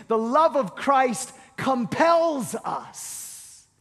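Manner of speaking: "compels us" drawn out slowly; deliberate, half-speed scripture quotation; slow emphatic quote. Acted. Quotes scripture for emphasis, then repeats.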